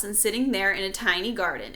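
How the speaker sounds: ordinary delivery, nothing marked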